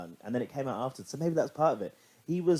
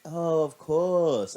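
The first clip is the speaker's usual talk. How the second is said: sympathetic; 6 semitones higher, 3 dB louder, much slower than usual. Retold or imitated. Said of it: "And I was like".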